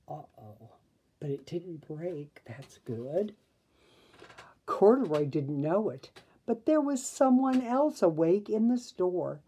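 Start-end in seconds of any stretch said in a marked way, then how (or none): 0.1-3.3 hushed aside. A narrator's reading. Narrator aside after the crash.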